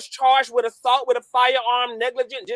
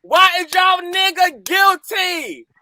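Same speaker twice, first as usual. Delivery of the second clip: gloating taunt; 6 semitones higher than usual. Real, not acted. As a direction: rub it in their faces. Taunting the defendant's fans.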